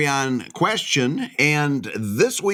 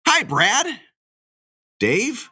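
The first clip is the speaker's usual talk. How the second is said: long pause between "Brad" and "Dave"; teasing pause; 6 semitones higher, slower than usual. Pretend. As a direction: leave Dave dangling after a teasing pause. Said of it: "Stop doing that".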